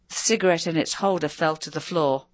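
usual speaking voice

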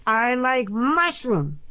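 wailing. A narrator's reading. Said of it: "a wail from the top".

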